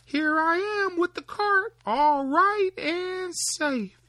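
very high, loud and slow; proud little Tom. Acted. Tom showing his father the cart, very high and proud. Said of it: "cried out".

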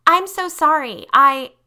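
high, loud, breaking plea; desperate apology. Acted. Lisa begging Tanya for mercy, panicking. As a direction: plead before being cut off.

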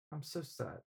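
a hushed, breathy-soft mock teacher voice; imitating teacher. Recalling English teacher's sad reaction, soft mock voice. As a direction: murmur it like a fond teacher. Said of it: "Hey, that's not her voice".

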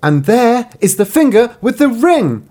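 high, loud and slow; triumphant reveal. Acted. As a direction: unmask the murderer, triumphant. Bride reveals the finger.

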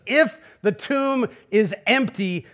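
slow, serious, weighing every word; slow and weighty. Shown as a demonstration. Preacher sets the hopeful case for the congregation, slow and grave. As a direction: lay each word down slowly and gravely. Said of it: "contrasting empty and full tomb".

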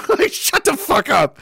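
high, drawn-out exasperation; exasperated disbelief. Acted. Answering the excuse for missing the attack, exasperated disbelief. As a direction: scoff it out slowly, high-pitched.

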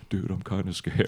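hushed, small admission of nerves; scared confession. Retold or imitated. Comedian to roommate in the next twin bed, scared hush. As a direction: admit it softly, half under your breath. Voicing roommates frightened in motel.

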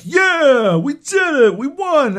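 loud, high-pitched celebrating; victory cheer. Retold or imitated. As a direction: whoop it up after winning a fight.

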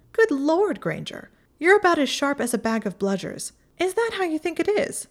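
mock her in disbelief; astonished Pansy. A narrator's reading. Pansy astonished at Hermione's naivety, loud and high.

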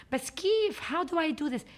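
high, pleading plea to Keith; high pleading question. Retold or imitated. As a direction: beg him, voice jumping up high. Pleading with Keith, acted quote.

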